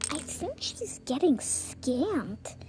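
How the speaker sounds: whisper. Acted. Aside to sibling in play.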